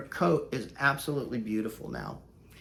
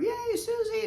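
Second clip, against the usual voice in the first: very high cheering dog voice; the boxers cheer at the little dog; high gleeful pet voice; excited boxers. Pretend.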